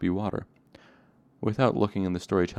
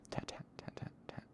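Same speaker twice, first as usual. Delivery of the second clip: whispered tat tat tapping; breathy drip noises; tapping drip sound; 16 dB quieter than usual. A narrator's reading. Dripping sound again.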